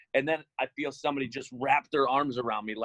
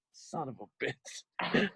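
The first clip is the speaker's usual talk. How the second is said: slow, softer mock despair; muttered curse; 5 dB quieter, much slower than usual. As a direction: groan it out, resigned. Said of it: "I'm like".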